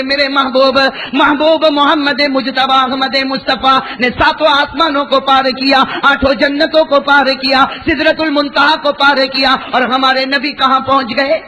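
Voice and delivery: high, ringing sermon delivery, slowed for effect; full-force preaching. Acted. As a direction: proclaim it to the crowd with rising fervour. (پورے جوش سے اونچی آواز میں وعظ)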